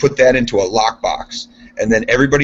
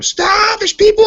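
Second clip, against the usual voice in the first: high, urgent reenacted scream; screaming warning; 4 dB louder, slower than usual. Worker retelling his warning at the furnace start, screaming. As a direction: scream it at him, pitch shooting way up. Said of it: "I was screaming at him".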